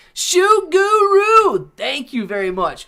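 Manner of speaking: high, drawn-out cheer of the name; excited shout. Real, not acted. Streamer thanking Shoe Guru for the donation, cheering loudly. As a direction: belt the name out slowly, overjoyed.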